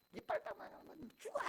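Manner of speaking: high, strained mock shriek; screaming mother. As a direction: imitate a mother shrieking threats without full volume. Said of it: "아침마다 소리 지르는 소리".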